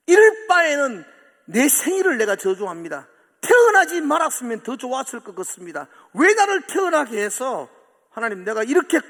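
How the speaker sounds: loud, high despairing lament; Job cursing his birth. Retold or imitated. Preacher voicing Job cursing his birthday, loud despair. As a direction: pour out despair, slowing at the end. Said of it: "욥은 그렇게 기도합니다".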